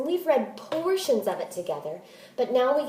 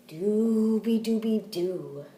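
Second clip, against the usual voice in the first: slow drowsy mooing chant; sleepy cow snore-song; 3 dB quieter, slower than usual. Acted. Teacher reading the cows' snore to the class, slow and drowsy. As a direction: croon it like a snoring cow. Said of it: "the cows snore".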